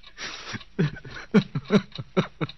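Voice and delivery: airless hysterical laugh; bitter unhinged laughter; breathy despairing laugh. Acted. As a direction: laugh on the edge of breaking. Hysterical laugh after child leaves.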